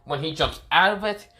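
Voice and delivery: ordinary delivery, nothing marked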